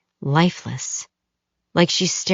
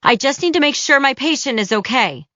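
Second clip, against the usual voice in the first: high, raised voice calling back; 8 semitones higher than usual. Acted. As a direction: call out while hurrying away. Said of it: "she calls out".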